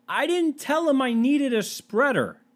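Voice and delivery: high, drawn-out disbelief; comically baffled, slow and high; incredulous protest. Acted. Bewildered protest in comic story.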